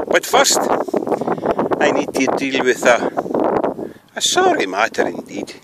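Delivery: ordinary talk, nothing marked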